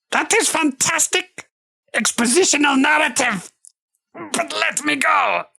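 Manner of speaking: high squeaky goblin protest; choked little goblin. Acted. Goblin being choked out, high strangled plea. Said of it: "hear the little goblin that you're choking out".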